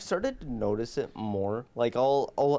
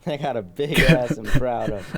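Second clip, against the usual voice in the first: laughing; 3 semitones higher, 8 dB louder than usual. Real, not acted. Laughing boasting about her body.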